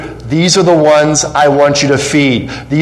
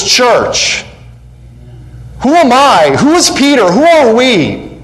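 full-force preaching; 8 semitones higher than usual. Acted. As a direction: build to a ringing crescendo.

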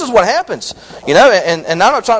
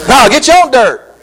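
higher and louder, driving the line home; emphatic repeat; 5 semitones higher, 6 dB louder than usual. Acted. Preacher repeating God's retort to the atheist, loud and emphatic. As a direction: hammer the punchline to the congregation.

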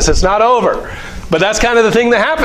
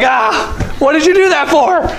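mock dismay; 10 semitones higher than usual. Disciple shocked at Jesus tossing the bucket, mock dismay. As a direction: cry out as the bucket goes overboard. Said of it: "You're just like".

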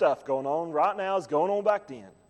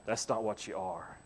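soft, hushed reassurance in a much lower voice; gentle Holy Spirit; 10 semitones lower, 8 dB quieter than usual. Retold or imitated. Holy Spirit softly telling Brother Josh who he is now, hushed. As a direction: whisper it gently like a comforting spirit. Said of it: "the Holy Spirit comes along and says".

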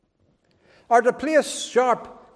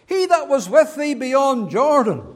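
high, loud insinuating quote; sneering accusers; 6 semitones higher, 7 dB louder than usual. Retold or imitated. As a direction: needle him with each phrase, cutting. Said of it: "these four brief statements".

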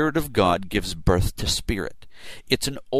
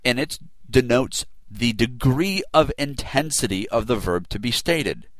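normal talking voice

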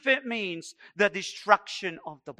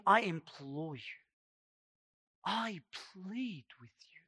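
hushed pleading; 2 semitones lower, 9 dB quieter than usual. Acted. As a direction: drop right down and plead gently. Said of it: "Paul begins by pleading".

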